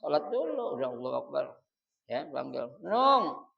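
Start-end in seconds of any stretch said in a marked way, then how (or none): 2.8-3.5 higher, louder call to a spouse. Acted. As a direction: call the name out sharply across the house. Acting a spouse calling out.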